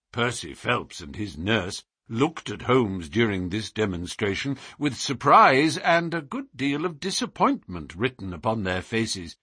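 usual speaking voice

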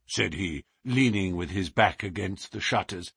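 ordinary delivery, nothing marked

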